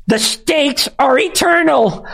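hammed-up high-pitched mockery of a grand phrase; mocking high pitch. Shown as a demonstration. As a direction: squeak out "eternal" like a mocking cartoon. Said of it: "Let's say it again".

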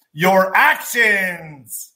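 "actions" drawn out very slowly; stress on actions. Real, not acted. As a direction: lean hard on "actions", slow and heavy. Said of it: "I care about your actions".

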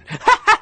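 short, high, scoffing laugh; high scornful laugh. Real, not acted. Host laughing at the general's spokesman statement, scornful laugh. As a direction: burst out laughing in disbelief.

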